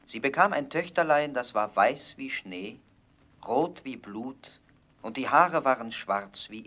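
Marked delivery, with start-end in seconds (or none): none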